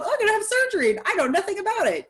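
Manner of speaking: high, quick mock patient voice; clueless patient quote. Mocking people who go into surgery uninformed, in a high quick voice. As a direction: play the naive patient who knows nothing, high and fast.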